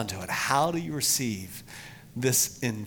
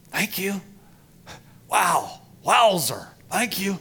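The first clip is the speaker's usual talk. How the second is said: grateful wonder; 8 semitones higher, 7 dB louder than usual. Pretend. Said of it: "I just say".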